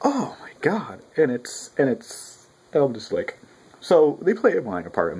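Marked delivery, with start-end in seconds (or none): 0.1-1.0 awed whisper. Real, not acted. Mock astonishment.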